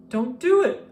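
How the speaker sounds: loud, high plea to stop; cried out. A narrator's reading. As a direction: cry out to stop him. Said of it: "cried Martha".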